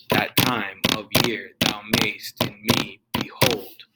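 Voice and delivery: slow da-DUM beat on "time", "year", "mayst", "me", "hold"; iambic stress. Shown as a demonstration. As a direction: beat out every second syllable of "That time of year thou mayst in me behold". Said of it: "That's an iambic pattern".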